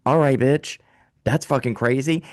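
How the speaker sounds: ordinary talk, nothing marked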